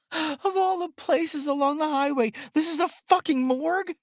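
high, loud bitter disbelief; terrified woman cursing her luck; panicked woman. A narrator's reading.

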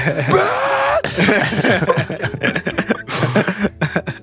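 long laugh. Real, not acted. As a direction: crack up for a while.

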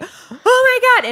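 very high, drawn-out excited squeal; thrilled shock. Retold or imitated. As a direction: re-enact hearing wonderful news, way up high. Acts out hearing of the engagement.

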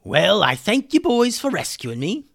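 grateful rescued pirate character. Acted. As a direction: thank your rescuers in a put-on pirate voice. Said Hummus.

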